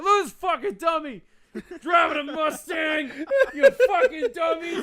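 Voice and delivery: high, slow, drawn-out shout; mocking old man's yell. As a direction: holler insults across the street, stretching words. Acted old man yelling insults outside.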